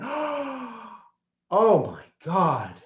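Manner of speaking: gasping oh my God, awestruck; breathless amazement; awed gasp. Retold or imitated. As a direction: catch your breath at the sight. Awed entering the house.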